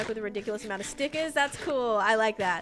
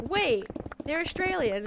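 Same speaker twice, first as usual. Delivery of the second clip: mock surprise; 7 semitones higher than usual. Streamer retelling her reaction to an Australian viewer, mock surprise. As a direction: act out suddenly catching on.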